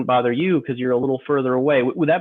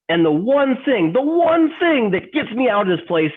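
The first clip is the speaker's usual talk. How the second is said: melodramatic outburst; 5 semitones higher than usual. Acted. Employee confessing to coworker Tom he hates the cubicle, melodramatic anguish. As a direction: voice climbing high in hammed-up anguish. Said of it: "does this seem like a melodrama".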